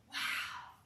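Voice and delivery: whispered amazement; hushed wow. Retold or imitated. Looking back at her twenty-year-old self, hushed wow. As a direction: let it out under the breath.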